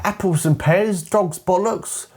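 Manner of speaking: high-pitched, put-on caricature of slang; mock Cockney slang. Mocking unrealistic British rhyming slang.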